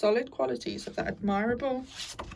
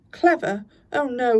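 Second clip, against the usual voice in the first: loud; 3 semitones higher, 9 dB louder than usual. Acted. Toad boasting falsely modest.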